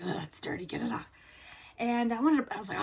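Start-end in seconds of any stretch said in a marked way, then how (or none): 0.0-1.1 disgusted mutter. Retold or imitated.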